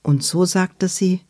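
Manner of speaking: ordinary delivery, nothing marked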